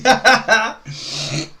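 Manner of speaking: a burst of high, loud laughter; high loud cackle. Real, not acted. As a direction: crack up loudly at the foul. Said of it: "Apologies for making these noises".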